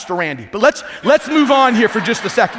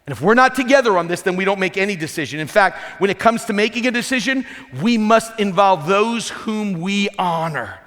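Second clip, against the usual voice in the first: usual speaking voice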